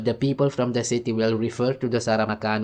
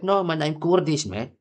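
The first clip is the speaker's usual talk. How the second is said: higher imitated correction; retold protest; 6 semitones higher than usual. Kurdish acquaintance correcting the speaker, higher mock protest. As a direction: act out the man insisting on his identity. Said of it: "he said".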